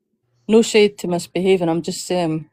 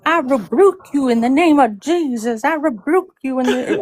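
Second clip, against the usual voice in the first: mock preachy rebuke; 6 semitones higher than usual. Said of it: "started going".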